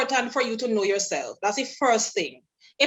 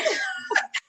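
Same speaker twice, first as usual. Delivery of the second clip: laughing. Real, not acted. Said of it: "that's my best imitation".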